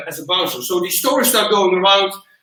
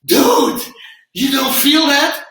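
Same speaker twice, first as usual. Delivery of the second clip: higher, louder, slow and astonished; amazed owner; 7 semitones higher, 5 dB louder, much slower than usual. Retold or imitated. Gym owner running up to the instructor, slow and astonished. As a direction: draw it out in disbelief. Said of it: "he goes".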